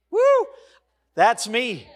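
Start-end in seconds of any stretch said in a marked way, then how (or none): 0.1-0.5 a high, loud joyful whoop; excited woo. Real, not acted. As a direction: shout out in delight. Preacher's exultant cry.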